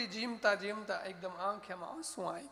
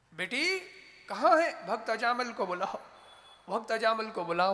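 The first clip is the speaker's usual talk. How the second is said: raised, higher voice hailing the household; insistent summons from the doorstep; calling out; 3 semitones higher, 8 dB louder than usual. Acted. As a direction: call loudly to the daughter of the house. Acted saint calling for Ajamil.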